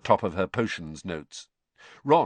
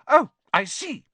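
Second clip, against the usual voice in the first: high, loud, offended retort; bristling; 6 dB louder than usual. A narrator's reading. Hermione taking offence at Ron, bristling. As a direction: bristle with indignation.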